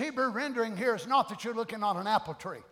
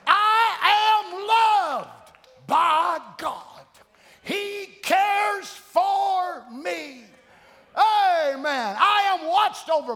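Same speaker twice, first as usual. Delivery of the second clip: high, loud, stretched-out preacher's shout; full-force preaching; 8 semitones higher, 8 dB louder, much slower than usual. Real, not acted. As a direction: proclaim each truth slowly to the whole congregation. Preaches declarations of faith.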